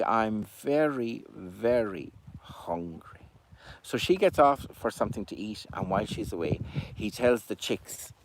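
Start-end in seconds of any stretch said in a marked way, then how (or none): 0.0-3.3 very slow, softer hungry plea; slow soft greedy cuckoo line; greedy drawl. Acted. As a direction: stretch out the hunger. Albert's greedy punchline.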